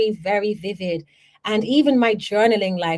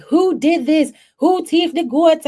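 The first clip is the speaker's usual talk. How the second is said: high, loud angry questioning; angry demand; 8 semitones higher, 5 dB louder than usual. Retold or imitated. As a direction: demand to know who stole them. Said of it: "they were like".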